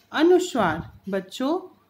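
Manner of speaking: normal talking voice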